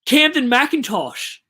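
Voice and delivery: high, loud, drawn-out name in disbelief; amused exclaim. Real, not acted. Streamer reading dad's team aloud, amused loud disbelief. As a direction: stretch the name out, loud and amazed.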